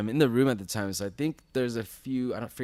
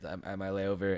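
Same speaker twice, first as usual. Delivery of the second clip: ordinary delivery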